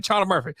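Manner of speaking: mimicking someone who leans on Charlie in Charlie Murphy; imitated emphasis on Charlie. Host mimicking how co-host said it, stressing Charlie. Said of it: "that's how he was doing it".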